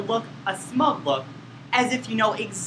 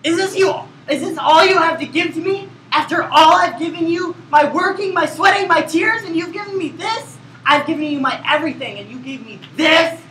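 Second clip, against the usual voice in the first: high, loud overwrought accusation; loud angry outburst; 6 semitones higher, 4 dB louder than usual. Shown as a demonstration. Actor shouts his hurt at his duet partner, loud and angry. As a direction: hurl it at your partner. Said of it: "the louder and angrier you get".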